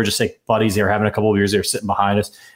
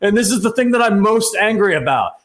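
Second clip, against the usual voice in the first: angry; 11 semitones higher than usual. Retold or imitated. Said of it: "I was like".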